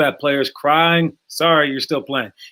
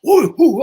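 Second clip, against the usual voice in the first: high, louder karate yelps; martial arts cries; 10 semitones higher, 6 dB louder than usual. Retold or imitated. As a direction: hoot it out like a mock karate move. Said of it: "like that".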